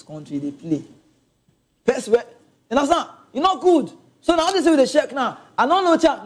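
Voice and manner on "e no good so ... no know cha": exasperated. Real, not acted. Exasperated rant.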